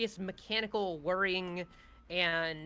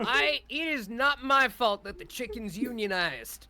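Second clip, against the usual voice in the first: villain voice; 4 semitones higher, 5 dB louder than usual. Acted. GM voicing villain Feastwood.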